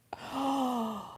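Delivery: mock gasp. Mock shock at giant chips dream.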